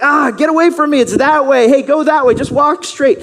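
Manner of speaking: high, loud shooing off a beggar; annoyed shout. Retold or imitated. Passersby shouting the blind man away, loud and annoyed. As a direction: wave him off loudly, annoyed. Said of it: "people are like".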